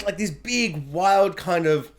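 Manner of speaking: ordinary talk, nothing marked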